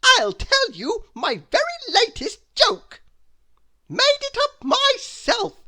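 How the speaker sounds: very high, loud goofy voice; a clown proud of his joke; silly Mister Funny. Acted. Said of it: "he added modestly".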